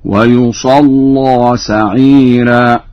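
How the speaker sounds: ويصلى as wa-yusalla, thick doubled lam and open final a; heavy lam. Shown as a demonstration. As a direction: recite ويصلى very slowly with a dark, full L. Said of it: "في تغليظ اللام فتح في ذات الياء". (ويصلى بضم الياء وتشديد اللام مغلظة مع الفتح)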